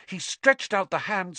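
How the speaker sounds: ordinary delivery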